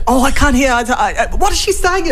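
mocking teacher. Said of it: "And she".

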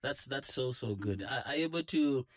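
ordinary talk, nothing marked

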